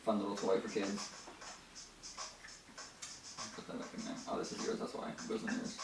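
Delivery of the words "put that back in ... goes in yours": hushed off-mic whisper; quietly whispered aside. Real, not acted. Off-mic aside while tidying.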